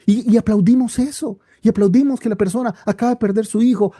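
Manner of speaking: ordinary delivery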